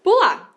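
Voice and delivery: pular popped up high like a little hop; playful jump sound. Shown as a demonstration. Teacher acting out the jump with a high pop. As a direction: pular as a bouncy sound effect. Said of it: "Gostou do meu onomatopeia do pular".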